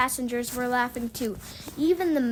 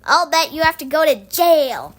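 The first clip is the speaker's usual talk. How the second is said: loud taunt, raised voice; gloating; 3 semitones higher, 8 dB louder than usual. A narrator's reading. Scooter to Henry about going to jail, gloating. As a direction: rub it in loudly. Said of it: "gloated Scooter".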